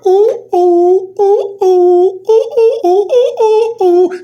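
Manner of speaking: high, loud ecstatic hooting; mock hooting. Vlogger imitates a gleeful Lou, high loud hooting. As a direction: hoot like a giddy monkey. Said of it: "Lou's like".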